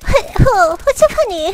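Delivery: high, loud mock Chinese accent; exaggerated high Chinese-accented voice; strong Chinese accent. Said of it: "in that strong accent".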